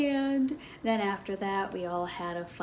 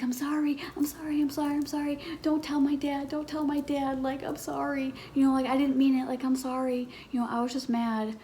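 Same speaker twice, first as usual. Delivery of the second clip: higher, breathy half-whispered begging; frantic pleading; 6 semitones higher, faster than usual. Retold or imitated. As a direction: plead like a scared kid caught out. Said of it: "I'm like".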